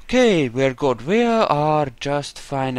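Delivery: normal talking voice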